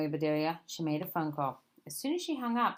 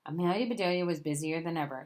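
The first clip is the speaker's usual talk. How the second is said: plain reading voice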